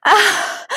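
high, loud gasping cry; sudden high "ah"; sharp gasp. A narrator's reading. Character gasping after being released.

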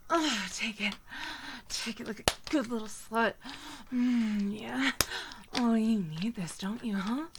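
ordinary talk, nothing marked

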